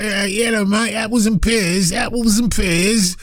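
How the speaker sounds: lightly mocking Cockney voice, apples and pears. Host mocking the caller's fake Cockney accent. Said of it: "they don't speak like".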